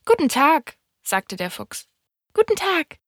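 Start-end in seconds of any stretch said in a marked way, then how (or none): 0.1-0.8 higher-pitched greeting from the fox; lightly voiced fox saying hello; fox's greeting. A narrator's reading. Fox greets the little prince, lightly voiced. 2.3-3.0 much higher, polite little prince; polite greeting. A narrator's reading. Little prince answers the fox's greeting, politely. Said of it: "antwortete höflich".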